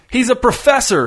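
shouting the hostage's name across the lawn. A narrator's reading. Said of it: "shouted Ray".

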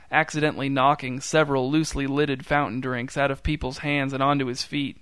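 ordinary delivery, nothing marked

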